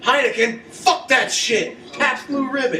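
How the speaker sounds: high, loud hammed-up bar rant; shouting down the beer brand in a hammed-up rant; mock rant. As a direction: yell it like a crazed barfly. Quoting film character's bar outburst.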